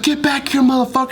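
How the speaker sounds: high-pitched retold yell; calling after a thief. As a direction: yell after someone getting away.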